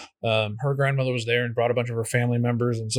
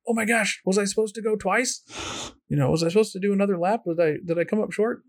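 anxious confusion; 8 semitones higher, faster than usual. Retold or imitated. Confused swimmer voiced in retelling.

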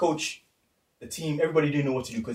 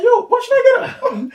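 laughing protest; 14 dB louder than usual. Retold or imitated. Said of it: "I was like".

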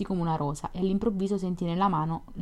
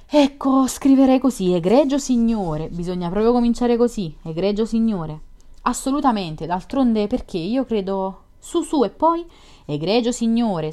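loud, higher-pitched, eager exchange; eager dictation; 4 semitones higher, 8 dB louder than usual. A narrator's reading. Two friends composing a letter aloud, loud and eager. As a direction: dictate the opening with rising eagerness, drawn out on the greeting.

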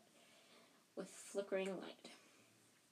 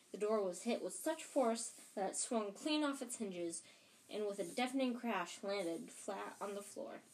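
ordinary delivery

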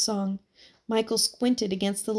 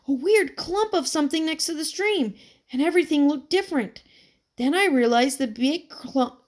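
higher and brisk, building wonder; eager boy's tale; 7 semitones higher than usual. A narrator's reading. Boy describes the fallen tree to his friend, higher and eager. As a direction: unfold the strange discovery.

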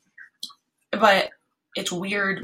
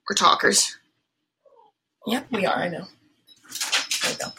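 pet talk; 3 semitones higher than usual. Real, not acted. Talking to her pet bird.

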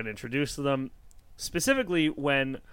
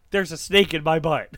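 a hint of the cowboy pull-string doll; lightly voiced toy cowboy line; Woody voice; 3 semitones higher, 6 dB louder than usual. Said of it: "It's when Woody, my favorite cowboy, says".

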